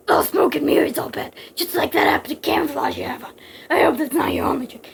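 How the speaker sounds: husky, rasping taunt; rough half-whispered action-hero voice; gruff, sarcastic hero. Acted. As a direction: growl back at the villain. Voices Snake taunting villain.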